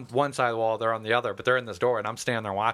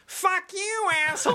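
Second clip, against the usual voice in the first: very high, hilarious squealed insult; mock high-pitched yell. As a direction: imitate a furious man's voice shooting way up. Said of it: "it got, like, high as shit".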